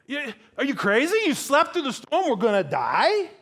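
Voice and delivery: high, loud, panicked disbelief; incredulous protest. Acted. Disciples to Jesus who slept through storm, incredulous. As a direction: throw it back in outraged disbelief.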